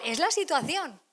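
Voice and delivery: ordinary talk, nothing marked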